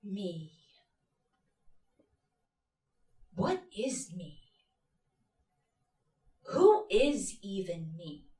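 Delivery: slow, soft, with long pauses; lost, hushed questioning. Acted. Asking herself who she is, lost. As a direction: let each question hang, quietly.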